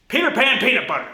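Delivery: loud, high mock tongue-twister gibberish; tongue-twister blowup. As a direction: give up and blurt it out loud and high. Tongue twister collapses into nonsense.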